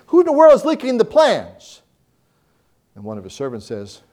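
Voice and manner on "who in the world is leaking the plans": angry king. Retold or imitated. King of Syria furious at leak.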